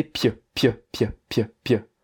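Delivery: "pieux" clipped to a short py; pieux without eu. Shown as a demonstration. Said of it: "tu enlèves le E à la fin".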